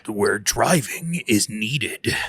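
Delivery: deep, slow trailer narrator; movie announcer voice. Pretend. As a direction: boom it out like a film trailer. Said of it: "my movie announcer voice".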